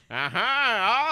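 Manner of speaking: high, slow scheming villain; mustache twirling villain. Acted. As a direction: gloat like a cartoon villain. Villain voiced in the story.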